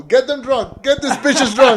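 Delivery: high, loud party chant; rowdy egging-on. Pretend. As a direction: whip the crowd up, half laughing. Egging listeners on in drinking game.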